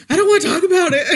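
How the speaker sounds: high, loud, laughing reply; mock protest through a laugh; laughing quote. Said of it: "I'm like".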